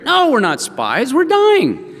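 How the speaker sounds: high, urgent denial; pleading protest. Acted. Brothers denying Joseph's accusation of spying, pleading protest. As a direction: protest it, pitch leaping up at the end.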